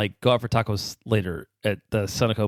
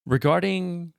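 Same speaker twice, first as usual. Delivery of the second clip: Regarding read out in a raised, formal tone; mock formal reading; 7 semitones higher, slower than usual. Host reading a listener question, mock formal. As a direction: start the question like a formal announcement. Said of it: "I'll try this".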